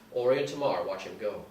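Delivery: normal talking voice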